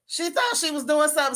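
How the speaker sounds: normal talking voice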